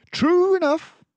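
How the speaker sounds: a composed mouse detective taking charge; Basil the detective. Acted. Basil agrees.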